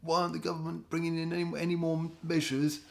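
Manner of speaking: high, fretting mock voice; hammed-up worried citizen panicking about cases; mock worrier. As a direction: whine it like a nervous pearl-clutcher. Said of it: "everybody who are into the mainstream narrative are".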